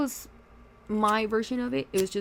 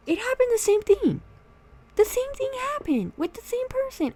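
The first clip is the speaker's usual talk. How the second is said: exasperated; 13 semitones higher, 5 dB louder than usual. Real, not acted. Exasperated retelling of drama.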